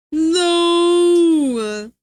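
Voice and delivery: long, loud, high drawn-out no; mock horrified cry. Streamer jokes about meeting a monster, mock horrified. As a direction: wail it out in mock protest.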